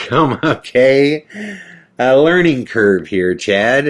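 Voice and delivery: loud, high and slow "Come, okay"; stunned realization. Retold or imitated. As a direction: it just dawned on you that you got played. Realizing he was scammed.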